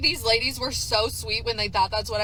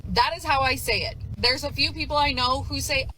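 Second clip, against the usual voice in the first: ordinary talk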